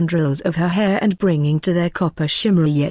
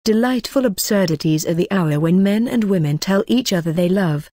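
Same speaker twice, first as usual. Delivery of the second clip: plain reading voice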